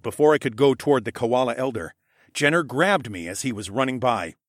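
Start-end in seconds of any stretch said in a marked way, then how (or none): none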